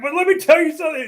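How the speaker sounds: high, loud heated outburst; fired up. Retold or imitated. Imitating the commenter getting fired up after claiming calm. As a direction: flare up suddenly, ready to rant. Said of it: "He got all fired up again".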